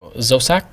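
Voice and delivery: sắc sliding upward; rising tone. Shown as a demonstration. Teacher introducing the first tone, sắc rising. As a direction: say Dâu sắc, pitch climbing on sắc. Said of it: "the rising tone".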